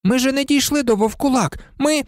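high-pitched pupil's protest; high, insistent girlish objection; sudden exclamation. A narrator's reading. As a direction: protest in a high voice until cut off. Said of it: "вигукнула раптом Парваті".

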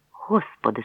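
ordinary delivery

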